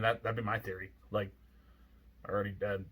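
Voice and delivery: ordinary talk, nothing marked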